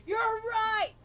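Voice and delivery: high, loud sarcastic agreement; mock-outraged yell. Answering friend about parents' mental health, mock-outraged yell. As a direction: belt it out in mock indignation.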